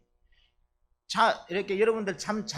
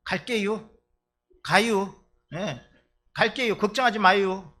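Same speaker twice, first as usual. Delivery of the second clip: cheerful empty promise, raised voice; mock Chungcheong elder; 2 semitones higher, 6 dB louder than usual. Preacher imitating an elder who promises to come, loud breezy reassurance. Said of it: "이러면".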